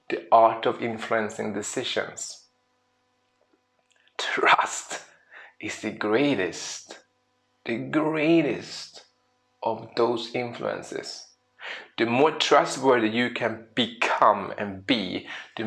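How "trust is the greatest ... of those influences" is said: slow solemn emphasis. Acted. Slow dramatic key statement.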